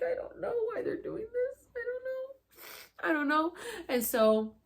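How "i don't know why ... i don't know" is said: tearful despair. Retold or imitated. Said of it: "I just kept shaking my head".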